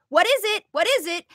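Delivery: high, pushy test question; a mild drill-sergeant parent testing the child; quizzing adult voice. Shown as a demonstration. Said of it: "Then you test it".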